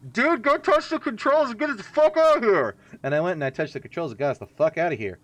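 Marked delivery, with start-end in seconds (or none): 0.1-2.8 high, hammed-up urgent order; mock commanding shout. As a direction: bark it like a frantic monster. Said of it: "he kinda just said".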